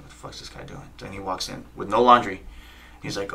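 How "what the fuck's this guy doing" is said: hushed, breathless whisper of alarm; whispered thought. Retold or imitated. Wife eyeing a stranger at the laundromat, whispered. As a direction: breathe it out under your breath. Wife's suspicious thought, retold.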